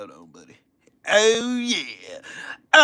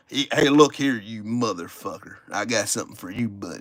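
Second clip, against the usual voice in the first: lightly voiced Southern MAGA guy, low; hammed-up Southern MAGA talk, a touch lower; mock MAGA voice; 8 semitones lower, 6 dB quieter than usual. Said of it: "Don't I sound like a fucking maga".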